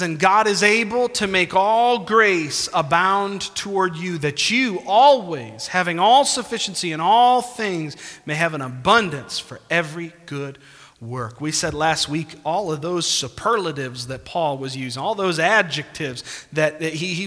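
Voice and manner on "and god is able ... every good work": raised, louder scripture reading. Acted. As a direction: proclaim the verse to the congregation. Reads scripture aloud.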